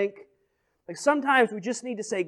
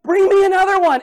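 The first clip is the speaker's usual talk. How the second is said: high, loud eager call; excited call; 10 semitones higher, 8 dB louder than usual. Retold or imitated. Mother to her sons, eagerly calling for more pots. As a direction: shout it out gleefully. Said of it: "she's like".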